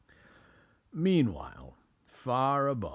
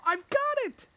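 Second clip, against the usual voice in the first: high squeaky eureka; excited mouse voice; 4 dB louder, faster than usual. A narrator's reading. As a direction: burst out like a thrilled little mouse. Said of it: "he said".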